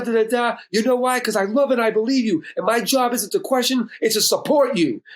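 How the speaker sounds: high-pitched, worked-up tirade; indignant outpouring, voice pushed up high; angry rant. Retold or imitated. Son berates dad before hanging up, angry.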